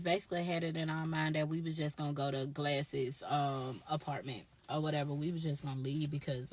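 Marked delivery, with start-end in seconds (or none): none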